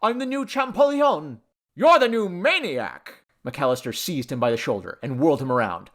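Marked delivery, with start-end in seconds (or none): 0.0-1.4 high, elated gushing; excited chatter. A narrator's reading. Man telling his girl of the discovery, elated. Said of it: "he chattered". 1.8-3.2 high, loud, stretched retort; indignant outburst. A narrator's reading. Rival calling the man mad, outraged.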